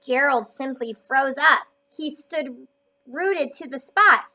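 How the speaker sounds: bright read-aloud for a class; reading to children. A narrator's reading. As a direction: read it out like a picture book for kids. Reads picture book aloud.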